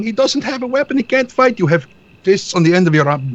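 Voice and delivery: high mock yell, retold; hammed-up high screaming of a fool. Mocking the guard who screamed at them, high mock yell. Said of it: "standing around screaming".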